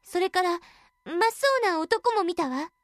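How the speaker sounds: put-on high, frightened young girl; a scared girl blurting it out. Girl reports in fear.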